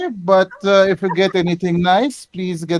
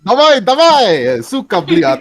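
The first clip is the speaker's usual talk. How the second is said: slow, loud Russian cursing, pitched up; Russian swearing; 3 semitones higher, 5 dB louder than usual. Acted. Character urging someone on, in a heavy Russian accent. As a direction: drag out the Russian curses in exasperation.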